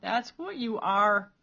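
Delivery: loud, slow tearful accusation; sobbing insult. A narrator's reading. Peter sobs out an insult at Cole. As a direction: spit it out between sobs. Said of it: "Peter sobbed".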